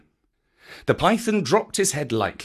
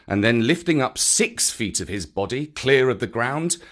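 ordinary delivery, nothing marked

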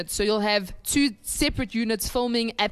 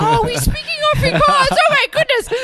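high, loud, laughing gasp of discovery; mock realization; 7 dB louder than usual. Friend mimics viewers realizing it's Afrikaans, high laughing squeal.

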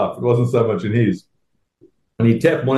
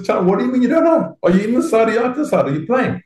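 impatient grilling; 12 semitones higher than usual. Retold or imitated. Joel grilling him in the room.

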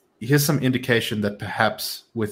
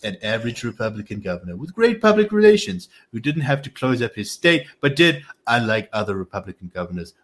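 emphatic political impression, swinging up on the insults; Donald Trump. Reading Trump's attack on DeSanctimonious to a co-host, mock-Trump voice. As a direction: play the boastful politician, punching names and jibes. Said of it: "do it in my Trump accent".

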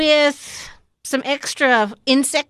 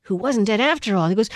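normal talking voice

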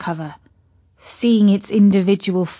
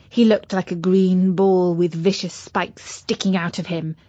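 plain reading voice

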